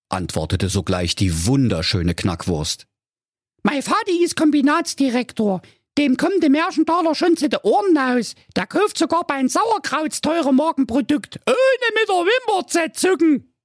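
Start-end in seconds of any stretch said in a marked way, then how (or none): 3.6-13.5 put-on very high squeaky daddy's girl; piping, show-off girl voice; boastful princess Carola. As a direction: brag about your rich father.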